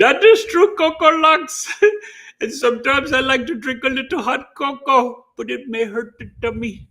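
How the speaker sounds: high squeaky comic voice; silly put-on voice. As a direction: play the cutesy cocoa character. Put-on voice about hot cocoa.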